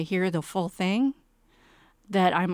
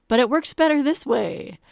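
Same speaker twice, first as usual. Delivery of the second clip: higher, louder imitation of a persistent director; mock insistence; 6 semitones higher, 5 dB louder than usual. Director arguing with playwright over a change, retold as insistent. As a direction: lightly imitate someone pushing their point.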